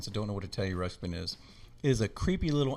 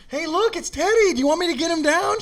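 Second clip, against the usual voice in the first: loud, very high mock-excited shout; gleeful taunt; 10 dB louder than usual. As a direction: taunt at full volume in a squealing pitch. Taunting sister with the toy.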